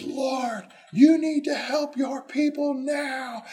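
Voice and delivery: calling up to the Lord; high, slow, desperate plea; Moses crying out. Acted. Moses cries to God.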